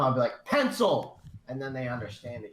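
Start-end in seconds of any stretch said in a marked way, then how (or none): 0.4-1.2 high, loud comic yell; louder. Retold or imitated. Repeating the word louder for a Spanish speaker, comic shout. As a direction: yell it to get understood. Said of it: "if you just say it louder".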